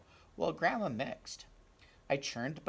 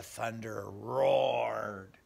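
slow, big booming narration; booming thunder; 4 dB louder, much slower than usual. A narrator's reading. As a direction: roll it out like thunder. Thunder roaring in story.

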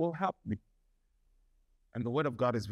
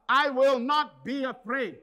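loud declaration; 10 semitones higher, 6 dB louder than usual. Shown as a demonstration. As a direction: proclaim it boldly. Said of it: "we have to say this".

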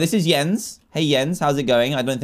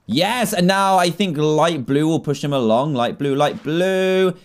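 usual speaking voice

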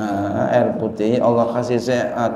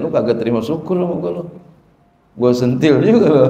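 the rain mock-scolding the people, fast; a half-playful telling-off, speeding up; mock threat from the rain; 3 dB louder, faster than usual. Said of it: "apa kata kita".

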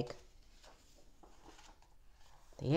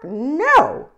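loud, squawky hen's "no"; high, loud storybook hen; 5 semitones higher, 9 dB louder than usual. Acted. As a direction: cluck it out like an indignant hen. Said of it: "said the hen".

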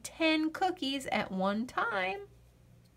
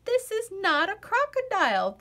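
high, louder, put-out correction; huffy child insisting; indignant correction; 9 semitones higher, 4 dB louder than usual. Acted. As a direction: set him straight, offended. Lola indignantly corrects Charlie.

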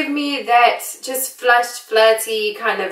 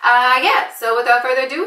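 usual speaking voice

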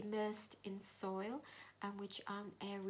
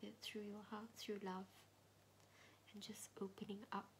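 meditation whisper; 5 dB quieter than usual. Acted. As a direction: whisper gently, guiding inward.

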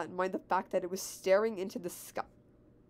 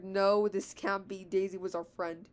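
usual speaking voice

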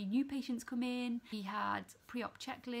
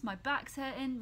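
ordinary delivery

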